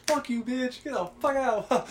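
high-pitched mock angry cussing, slowing at the end; retold cussing match. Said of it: "we were just cussing".

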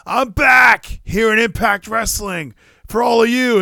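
a hint of a wrestler shouting a promo, slowed; half-hearted mock promo yell. Mimicking Josh Alexander cutting a promo, mock shout. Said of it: "trying to cut a promo like".

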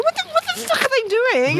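very high-pitched frantic outburst; hysterical. Retold or imitated. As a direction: re-enact hysterics, pitch shooting up. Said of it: "I just got hysterical".

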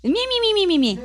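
high squeaky little creature voice; unicorn squeak. Pretend. Host squeaking as the guest unicorn, high and playful. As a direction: squeak like a tiny unicorn mascot. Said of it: "Hablo su idioma".

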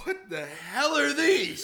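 joking as the dad finding the syringes, mock alarm. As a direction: play the shocked father through a laugh. Mocking the worried dad.

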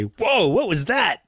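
high, drawn-out "whoa" of wonder; startled amazement. Acted. Host acting out a listener struck by a surprise harmony, amazed. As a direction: act out being stopped short, pitch leaping up.

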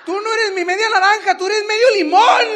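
high, very loud comic tirade; hammed-up shouted insult, all out; angry yell. Husband insulting his wife as half lemon, angry yell.

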